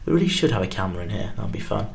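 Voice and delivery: usual speaking voice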